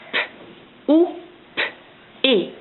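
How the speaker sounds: each sound said apart; segmented sounds. Shown as a demonstration. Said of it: "je vais te dire les sons d'un mot".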